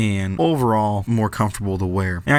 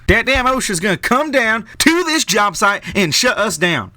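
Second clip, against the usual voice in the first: put-on southern accent; 11 semitones higher, 4 dB louder than usual. Imitating dad and grandpa grumbling about OSHA, in a hammed-up Southern accent. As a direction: ham up a Southern accent, mocking the old-timers. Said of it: "they don't talk in a southern accent".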